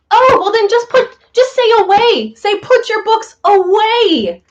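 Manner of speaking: annoyed, insisting on the right phrase; exasperated retort. Retold or imitated.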